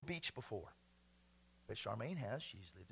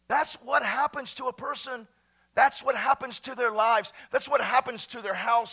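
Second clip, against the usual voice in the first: rising loud, higher pitch; rising to a fiery shout; loud preaching; 7 semitones higher, 10 dB louder than usual. Real, not acted. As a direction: preach it at full force.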